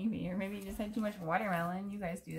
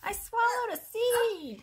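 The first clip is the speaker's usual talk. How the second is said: high, slow wail of panic; panicked crocodile; 7 dB louder, much slower than usual. Acted. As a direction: cry out in alarm as the crocodile. Crocodile panics over swallowed seed.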